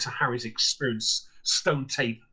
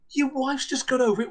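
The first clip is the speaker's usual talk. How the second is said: much higher, disbelieving repeat; incredulous echo; 11 semitones higher than usual. Retold or imitated. Landlady repeats the guest's words back, high and incredulous.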